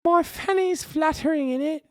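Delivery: very high mock British falsetto; high-pitched British voice. Streamer clowning for chat, high-pitched British voice. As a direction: squeak it out as a British caricature.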